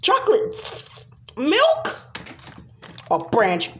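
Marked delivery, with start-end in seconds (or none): 0.0-2.0 put-on 1 whiny kid voice. Toy voice crying for chocolate milk.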